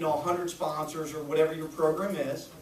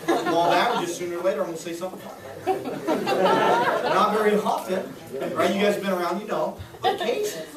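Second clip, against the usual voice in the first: laughing through the words; chuckling at his own bad jokes; 2 semitones higher, 4 dB louder than usual. Real, not acted. Teacher laughing at himself in front of the class, laughing. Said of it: "laughing at his own jokes".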